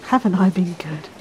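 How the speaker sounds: ordinary delivery, nothing marked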